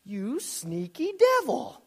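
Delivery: high-pitched, put-on indignation; mock outrage. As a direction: scold the devil in a high, hammed-up voice.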